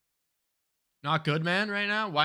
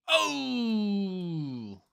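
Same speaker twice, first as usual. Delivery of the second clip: drawn-out falling wail; 2 semitones higher than usual. Real, not acted. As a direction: let out a long, sliding "ohhh". Reacts to the guess result.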